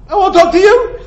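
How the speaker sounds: high, loud mock pout; a hammed-up huffy wife shutting him out; wife refusing to talk to her husband, high mock sulk; sulky wife voice.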